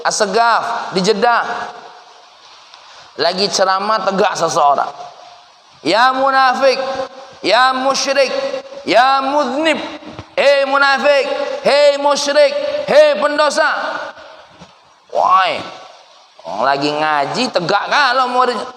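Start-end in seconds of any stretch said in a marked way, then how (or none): 5.8-13.7 accusing shout. Acted. A man in the crowd berating the preaching Habib, accusing. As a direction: hurl each insult at him.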